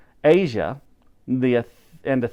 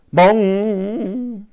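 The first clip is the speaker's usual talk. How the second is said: louder, voiced bell toll; clock bong; 7 semitones higher, 4 dB louder than usual. A narrator's reading. Narrator voicing Big Ben striking, a loud booming bong.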